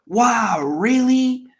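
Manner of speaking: high, loud WOW and REALLY; emphatic amazement. Shown as a demonstration. Teacher showing listeners what emphasis sounds like, emphatic amazement. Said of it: "He is doing some emphasis".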